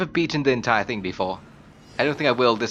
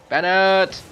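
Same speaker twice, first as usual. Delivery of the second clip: loud, raised-pitch call; 7 semitones higher, 6 dB louder than usual. Real, not acted. Player summons Bennett in a fight, loud call. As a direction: call the name sharply, higher and louder. Calling the game character.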